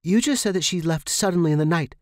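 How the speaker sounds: high, loud and fast demand. Acted. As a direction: corner her with the question. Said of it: "I demanded".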